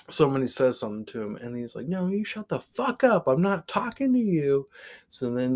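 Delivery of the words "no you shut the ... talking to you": high, sharp retort; mock angry snap. Shoeless man snapping at a passenger, high and sharp. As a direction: bite back at the stranger, pitched up.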